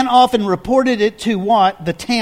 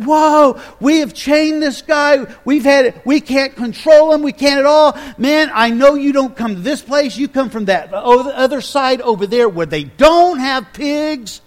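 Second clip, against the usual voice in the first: higher, louder awed rush of words; amazed townspeople; 6 semitones higher, 3 dB louder than usual. Retold or imitated. Townspeople meet Jesus at the shore, loud and amazed. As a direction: gush at Jesus in astonishment. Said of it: "the people go down there going".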